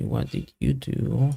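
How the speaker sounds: ordinary delivery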